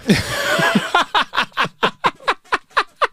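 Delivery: long high burst of laughter. Real, not acted. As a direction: laugh at your own punchline. Laugh after punchline.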